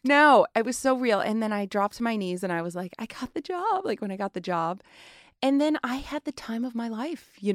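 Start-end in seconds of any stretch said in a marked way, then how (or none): 3.0-3.9 high-pitched retold delight at good news; retold elation. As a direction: squeak it out in re-enacted joy. Retold joy at landing the role.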